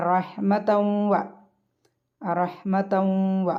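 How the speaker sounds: റഹ്മതൗ വ recited slowly, the tanween melting into a long nasal "w"; merging with ghunna. Shown as a demonstration. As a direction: hum through the join into വ in റഹ്മതൗ വ. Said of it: "മണിച്ചുകൊണ്ട് ലയിപ്പിക്കുക".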